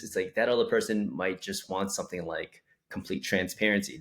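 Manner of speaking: normal talking voice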